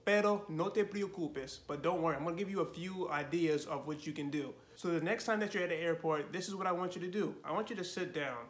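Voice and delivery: ordinary talk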